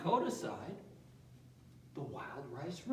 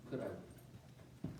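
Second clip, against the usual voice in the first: whispered aside; 9 semitones lower, 11 dB quieter than usual. Real, not acted. Muttered aside.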